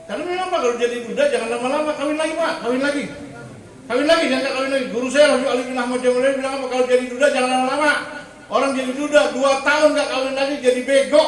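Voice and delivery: high, loud sermon exhortation; forceful preaching. Real, not acted. Preacher passes on his teacher's advice to widowers, forceful preaching. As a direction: urge the congregation on at full voice.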